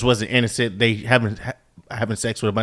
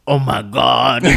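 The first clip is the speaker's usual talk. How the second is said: put-on raspy voice; 4 dB louder, slower than usual. Shown as a demonstration. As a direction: put a little rasp on it. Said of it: "make your voice a little raspy".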